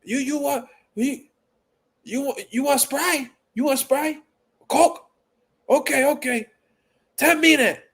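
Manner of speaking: high put-on voice throughout; high hurried order-taker voice; impression of takeout lady. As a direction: rattle off the drinks like the woman at the counter. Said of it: "I was like".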